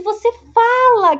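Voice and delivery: high, loud, slow amazed you talk; mock astonished patient. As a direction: stretch it out in disbelief. Patients amazed therapist talks.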